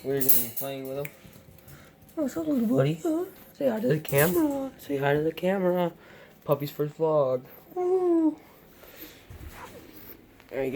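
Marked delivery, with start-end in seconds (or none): 2.2-4.7 puppy talk. Real, not acted. Cooing at the new puppy. 7.8-8.4 a high, bright cheer; excited whoop. Real, not acted. Cheering puppy's first vlog.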